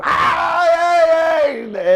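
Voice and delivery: high-pitched mock scream; imitated scream. Coco screaming in the back of the van, imitated. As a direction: yelp out in acted panic. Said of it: "Coco's screaming now for bloody murder".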